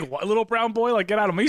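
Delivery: high-pitched mock bafflement; baffled girl. Imagined girl reacting to the suitor, high mock disbelief. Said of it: "She's like".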